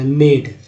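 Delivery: मेड with a long clear "ay" vowel; मेड said crisply, vowel stretched; pronunciation model. Shown as a demonstration. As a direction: say मेड as in "made". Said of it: "ए का उच्चारण fix ए ही के रूप में होता".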